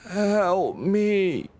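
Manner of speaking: high, wailing creature cry; snorting creature's plea. Acted. Game master voices angry creature answering the player, high eerie plea. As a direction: wail it as an enraged beast pleading. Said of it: "like, kinda makes a snort".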